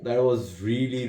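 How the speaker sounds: ordinary talk, nothing marked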